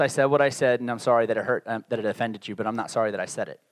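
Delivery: very low, soft, unrepentant; grudging non-apology. Acted. Preacher voices someone not really sorry for offending, grudging non-apology. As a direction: drop it low and toss off the apology.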